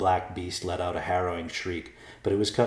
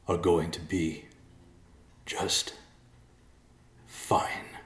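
faint, halting last words; dying words; much slower than usual. A narrator's reading. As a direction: let it fade out between long pauses.

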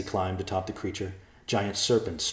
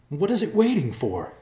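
tense question; 12 semitones higher, 6 dB louder than usual. A narrator's reading. Tense wait for the Guardian.

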